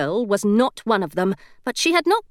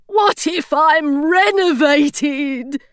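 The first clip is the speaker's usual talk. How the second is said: higher, very slow fearful gargoyle; worried gargoyle; 7 semitones higher, 3 dB louder, much slower than usual. A narrator's reading. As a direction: dread of being renovated. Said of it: "really concerned".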